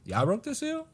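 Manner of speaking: put-on voice, pitched right up; incredulous questioning. Samura confronting Mini Masumi about the seal, incredulous.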